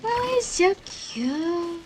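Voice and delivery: loud, drawn-out coo of delight; high, stretched-out delighted reaction; real cute gushing. Real, not acted. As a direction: gush slowly over something adorable. Player gushes at cute cows.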